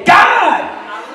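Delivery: high-pitched cry to God. Acted. Preacher showing how to cry out to God, impassioned cry. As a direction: call up to heaven with full voice. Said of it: "cry out to God and say".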